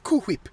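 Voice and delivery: "Cool Whip" with a breathy "hw" in Whip; soft, puff an h into "Cool Whip"; Stewie. Acted. Friend showing Stewie's odd "Cool Whip", breathy hw. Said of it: "I like to do the Cool Whip".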